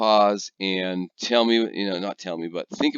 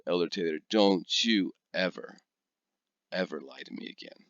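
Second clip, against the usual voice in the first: stern warning; 3 semitones lower, 4 dB quieter than usual. Retold or imitated. German host scolds the missionary.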